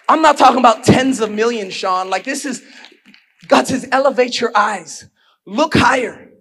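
loud, rising preacher's delivery; preaching at full force. Acted. Pastor pressing the church hard, booming preaching. Preacher builds again, near sung.

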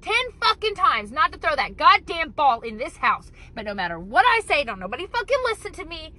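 put-on loud, exasperated shout at the kids; angry yelling mom. Mom yelling at kids about throwing balls, loud exasperated shout. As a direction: blow up at the kids over the ball. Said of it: "that's where you'd hear that".

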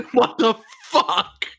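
laughing. Real, not acted. Laughs while hurting from crash.

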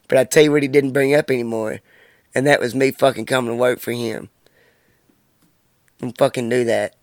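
normal talking voice

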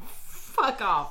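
stunned mock disbelief. Acts Jeff's stunned reply.

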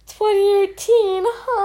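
high, baffled question; confused Lena. Retold or imitated. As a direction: rise way up in disbelief. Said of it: "Lena is like".

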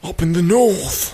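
slow, exaggerated Northern English drawl; mock Northern English accent. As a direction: echo it in a fake Northern accent.

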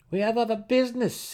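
high curt reminder; pointed. A narrator's reading. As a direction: cut him off pointedly. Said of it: "he pointedly reminded Jarlaxle".

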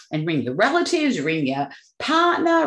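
ordinary delivery

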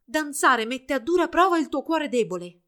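high, loud scolding; reproachful. A narrator's reading. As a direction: lecture your daughter sternly. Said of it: "per rimproverarla".

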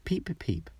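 high strange peeps; an ugly duckling's first cry; odd duckling peeping. A narrator's reading. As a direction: peep like a big clumsy chick.

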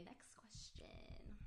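slow, drawn-out whisper. Real, not acted. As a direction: whisper it, stretching the words out. Quietly moving to next question.

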